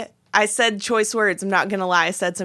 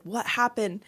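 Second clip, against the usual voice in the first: softer, close-up question; understated concern; 7 dB quieter than usual. Retold or imitated. Husband finds wife on the floor, understated concern. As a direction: ask it gently, mimicking the husband. Husband now beside her, asking.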